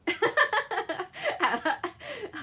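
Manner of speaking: high, loud burst of laughter; real shrieking laugh; squealing laugh. Real, not acted. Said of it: "Oh, [name], not like that".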